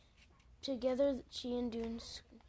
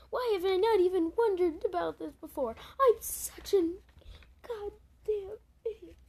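self-scolding dismay; 8 semitones higher, 8 dB louder than usual. Pretend. Reader voices self-scolding dragon.

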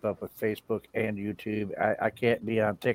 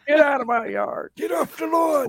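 put-on high shouted cranky old man; shouting at trespasser; 13 semitones higher than usual. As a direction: yell at kids off the lawn. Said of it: "Screw TikTok, shakes cane".

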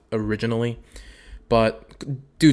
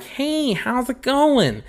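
put-on very high, slow girly greeting; high mock-innocent girl voice; sweet girl voice; 12 semitones higher, slower than usual. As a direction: chirp it like a shy sweet girl. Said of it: "she's gonna be like".

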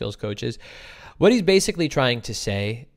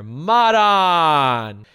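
calling out; 4 semitones higher, 10 dB louder than usual. Real, not acted. Greeting a viewer by name.

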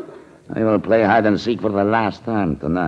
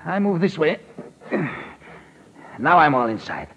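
anxious; 6 semitones higher than usual. Acted. Climbing into the trunk, anxious.

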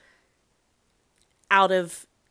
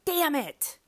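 mock frustration; 9 semitones higher than usual. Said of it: "and you're like".

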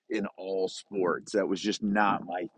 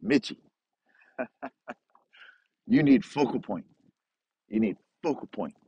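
put-on Korean accent. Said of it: "explained it some more in, in broken in English".

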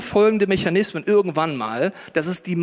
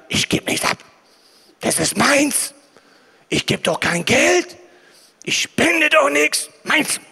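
put-on greedy toddler voice; 8 semitones higher, 3 dB louder, slower than usual. Adults acting like greedy toddlers.

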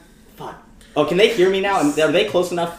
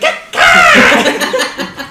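evil cackle; 5 dB louder than usual. Acted. Player's villain laugh.

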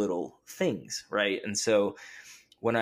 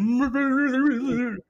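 mumbling noise; 7 dB louder than usual. Retold or imitated. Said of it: "like, mumbling noise".

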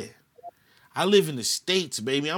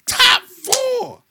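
incredulous laugh; 6 dB louder than usual. Real, not acted.

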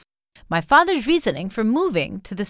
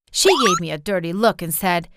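usual speaking voice

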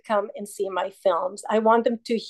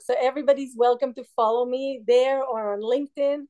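normal talking voice

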